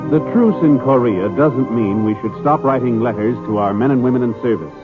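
ordinary talk, nothing marked

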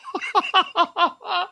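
high breathy giggle after a joke. Real, not acted. Laughing at name.

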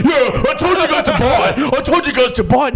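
high, laughing know-it-all brag; mock smug gloating. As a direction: lord it over the group in a high mock voice. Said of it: "he's like".

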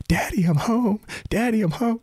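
put-on high, small toddler voice calling out; sweet little boy. Father imitating his toddler son arriving home, high and sweet. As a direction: sing it out like a happy little kid. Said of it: "in his sweet little voice".

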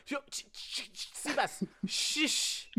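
whispered shh sounds to hush him; imitating a hushed classroom shush; mock shushing. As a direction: hiss at him to keep it down.

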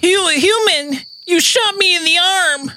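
high, strange alien voice. Acted. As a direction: speak like a wounded alien.

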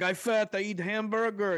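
high, loud, badly faked foreign-guy American; booming mock American with a thick foreign accent; foreigner's funny American accent. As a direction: ham up a foreigner imitating an American. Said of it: "has the really funny American accent".

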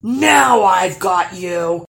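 triumphant troll. Acted. Troll catches fish.

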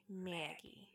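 barely audible echo of the name; faint whisper. Shown as a demonstration. Host echoes the character's name, fading away. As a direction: let the name fade almost to nothing. Dramatic entrance of Maggie.

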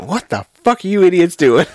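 milder retelling of an exasperated yell; a lighter mock scold, half-hearted; imitating Kelly. Retelling Kelly's reaction to friends, mild mock scold. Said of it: "Kelly just pokes her head out".

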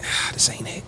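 breathy mock whisper to himself; hushed, unimpressed whisper; whispered aside. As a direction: mutter it under the breath, disappointed. Retold reaction at the resort.

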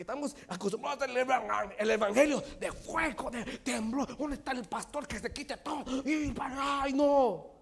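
fiery preaching. Acted. Preacher mocking taste for fiery gospel, building loud. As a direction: work it up like a fire preacher. Mimics fire-and-thunder preaching.

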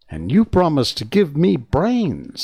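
Scarecrow voice. Acted. Scarecrow demands brains.

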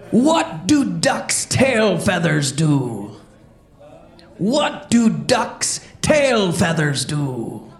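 pirate voice. Acted. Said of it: "let's hear it for Pete the Pirate".

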